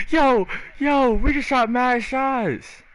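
high, drawn-out excited cry; hyped disbelief. Real, not acted. Gamer reacting to the team's win, hyped disbelief. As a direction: stretch it out high and slow, amazed.

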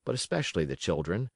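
plain reading voice

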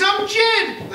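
high, loud disbelief; astonished. Acted. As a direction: gasp it out in shock. Husband hears he died.

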